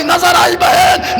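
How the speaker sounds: high, loud, drawn-out cry; wailed lament. Acted. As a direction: wail the question to the crowd. Wailed question at climax. (ऊँची आवाज़ में खींचकर रोती पुकार)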